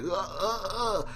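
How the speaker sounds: high strained mock groans; dying groans. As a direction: groan like a gutted man. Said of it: "he's like".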